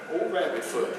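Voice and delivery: low, soft private aside; thinking to himself. Acted. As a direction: say it softly, as a thought to yourself. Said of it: "I'm thinking to myself".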